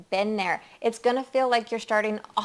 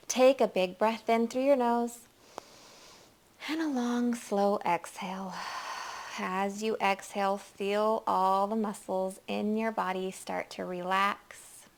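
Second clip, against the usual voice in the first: guided meditation; 4 dB quieter than usual. Acted. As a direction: ease them into relaxing, hushed and unhurried.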